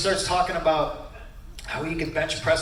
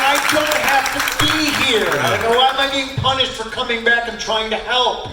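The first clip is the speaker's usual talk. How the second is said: mock indignant protest; 8 semitones higher, 5 dB louder than usual.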